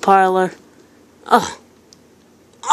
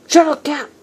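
annoyed yell; 7 semitones higher, 4 dB louder than usual. Real, not acted. Yelling at game noise.

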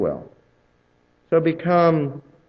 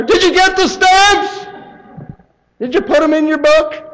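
very high, loud, slowed squeal; shrieking delight over store stamps; gushing shopper; 8 dB louder than usual. Retold or imitated. Imitating the stamp enthusiast again.

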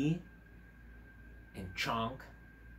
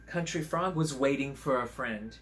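ordinary delivery

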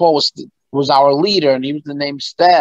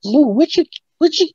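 high-pitched imitation of a woman caller; mock baffled phone caller; retold friend's question; 12 semitones higher than usual. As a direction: voice her puzzled question way up high. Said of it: "Tisha Campbell calling me".

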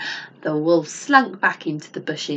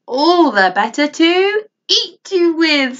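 loud, slow wolf snarl; 10 semitones higher, 8 dB louder, slower than usual. A narrator's reading. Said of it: "snarled the wolf".

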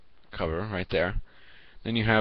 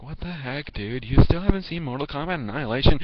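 mocking older viewer; 6 semitones higher than usual. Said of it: "would have been like".

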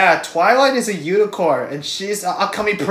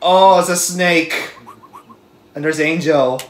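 normal talking voice

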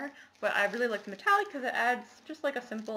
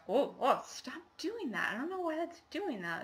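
higher-pitched annoyed outburst; 7 semitones higher than usual. Real, not acted. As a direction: fuss at a gadget that keeps acting up. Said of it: "It's really annoying".

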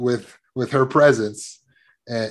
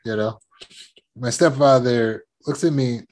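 ordinary delivery

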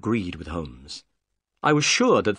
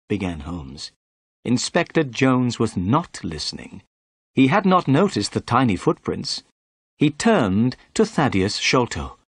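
ordinary delivery

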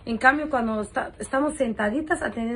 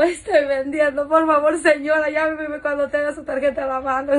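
exasperated plea; 4 semitones higher, 5 dB louder than usual. Retold or imitated. Live seller to a customer without her card, exasperated. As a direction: plead loudly, at the end of your patience. Exasperated vendor answering such a caller.

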